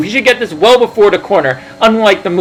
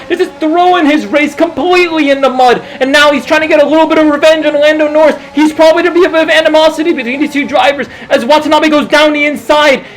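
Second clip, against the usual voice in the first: motorsport commentator; 6 semitones higher than usual. Acted. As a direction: call every move as it happens.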